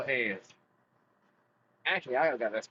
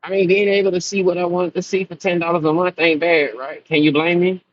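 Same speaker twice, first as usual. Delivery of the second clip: loud; 6 semitones higher, 10 dB louder than usual. Acted. Defends paying for OnlyFans, laughing.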